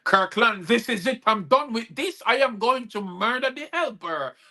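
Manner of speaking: raging woman. Retold or imitated. Wife threatening to murder the helper, mock rage. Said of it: "she was fuming in raging temper".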